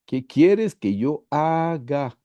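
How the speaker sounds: very slow question, "haga" drawn out; stress on HAGA. Shown as a demonstration. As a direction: spell out that the key word is "haga". Contrasta pensar, decir y hacer. (énfasis muy lento y marcado en "haga")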